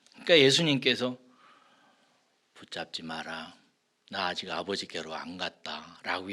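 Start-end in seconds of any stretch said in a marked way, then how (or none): 2.5-6.3 cool detachment. Acted. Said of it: "주님 참 냉정하죠".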